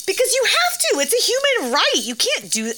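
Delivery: high-pitched, exasperated insistence; indignant protest. Real, not acted. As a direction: argue it as obvious, pitch jumping right up. Ranting about the bathroom refusal.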